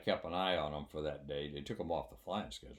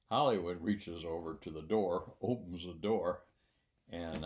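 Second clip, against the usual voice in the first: ordinary delivery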